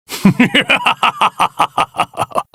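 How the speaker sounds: high triumphant villain laugh; rising gloat of a self-styled god. Acted. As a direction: revel in your own power. Strength nearing perfection.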